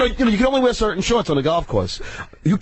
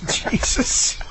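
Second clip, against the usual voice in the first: slow, high, breathy mock of the staff teasing; mocking sales staff; 7 semitones higher, much slower than usual. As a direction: drag it out, high and mocking, like coworkers rubbing it in. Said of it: "they're going".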